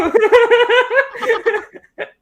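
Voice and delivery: long, high-pitched fit of laughter. Real, not acted. Co-host laughing at how fast he talks, long and high.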